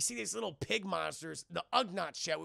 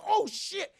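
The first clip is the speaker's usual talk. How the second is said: higher, louder startled curse; mock alarm; 13 semitones higher, 7 dB louder than usual. Heroes as Vader stands, loud startled curse. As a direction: blurt it in sudden panic.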